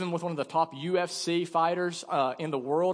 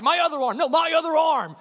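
louder, higher, exasperated correction; impatient order; 8 semitones higher, 6 dB louder than usual. Retold or imitated. Fighter correcting the pastor's grip, impatient loud order. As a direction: correct him sharply, losing patience.

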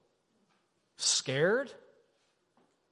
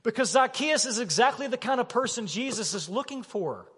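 higher and louder, pressing the point; loud, eager Jesus; 6 semitones higher, 4 dB louder than usual. Acted. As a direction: voice Jesus with urgent excitement. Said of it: "And Jesus says".

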